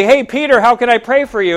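mock-greet someone across the church; acted hearty greeting. Churchgoer greeting Peter to offer prayer, acted and hearty.

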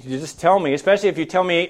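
normal talking voice

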